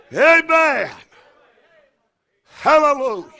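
loud, high exultant amen with a laugh; shouted church amen; joyful shout. Real, not acted. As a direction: cry out in praise. Shouted praise after climax.

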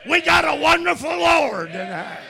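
loud, high, shouted preaching; preaching at full force. Acted. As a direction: thunder it out to the congregation.

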